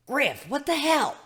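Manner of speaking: high, loud startled yell. A narrator's reading. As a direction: shout it, yanked awake and furious. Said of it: "Gilda yelled".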